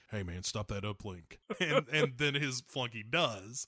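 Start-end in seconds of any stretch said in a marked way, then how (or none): 0.1-1.4 low, hushed mock confidential order; quiet order. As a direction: slip it discreetly to a flunky. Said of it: "quietly tells one of his flunkies".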